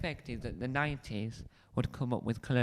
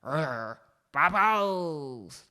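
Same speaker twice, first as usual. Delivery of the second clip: angry growl into a loud high "bubbles"; growl, then yell it furiously, hammed-up; mock-furious shout; 4 semitones higher, 4 dB louder, much slower than usual. Shown as a demonstration. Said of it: "so let's go angry".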